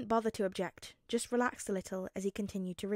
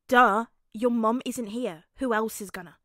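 bossy; 8 dB louder than usual. Acted. Child bossy retort.

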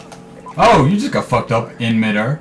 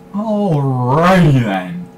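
"Alrighty" stretched very slowly, louder, put-on; slow, mock-comic alrighty; 3 semitones higher, 4 dB louder, much slower than usual. Shown as a demonstration. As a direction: drag out "Alrighty then" in a cartoonish voice. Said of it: "Well, alrighty then".

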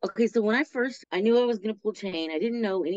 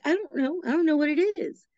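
mocking whine; 10 semitones higher, 3 dB louder than usual. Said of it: "It's like".

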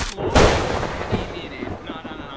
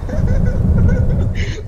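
loud laugh; 11 dB louder than usual. Real, not acted. Laughs at friend's mistake.

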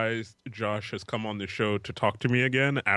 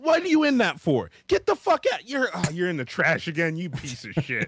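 mock yelling at pet; 6 semitones higher than usual. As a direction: yell at the animal like it's the drunkest guy at the bar. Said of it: "You're just like".

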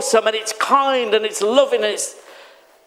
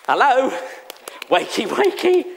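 playful wake-up call; 2 semitones higher than usual. Acted. Preacher teases the sleepy congregation, playful. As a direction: tease them awake.

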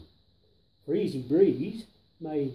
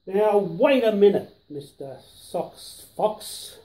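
interrupting; 3 semitones higher, 7 dB louder than usual. A narrator's reading. Knox interrupts Fox's rhyme.

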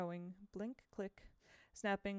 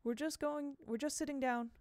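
put-on nervous boy; 6 semitones higher than usual. Boy covering, eager to leave.

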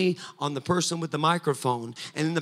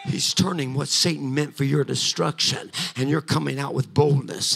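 usual speaking voice

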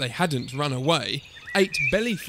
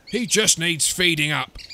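dinosaur dad; 4 dB louder than usual. A narrator's reading. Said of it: "said Dad".

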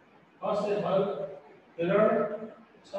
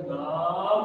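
very slow नाम with a rising end; teacher sounding out नाम for children; slow blend; much slower than usual. Shown as a demonstration. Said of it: "माँ को धीरे से बोलेंगे".

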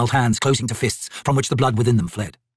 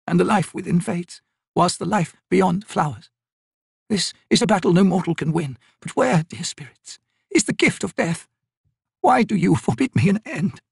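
put-on weary, bitter old woman; 9 semitones higher than usual. Old Maibi refusing the raven's help, weary and bitter. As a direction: push away every hope, tired and bitter.

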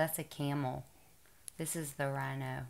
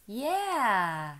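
put-on reading to a toddler; 3 semitones higher, 3 dB louder than usual. Mother reading picture book to toddler.

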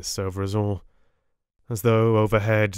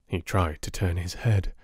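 usual speaking voice

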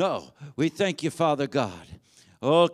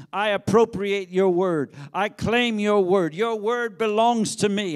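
bold declaration; 4 dB louder than usual. Acted. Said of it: "say it to yourself".

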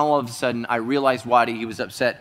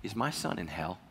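quiet, lower, broken question; a grieving father asks through tears; tearful question; 6 semitones lower, 12 dB quieter than usual. Retold or imitated. Soft, tearful plea from the grieving friend. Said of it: "with tears in his face".

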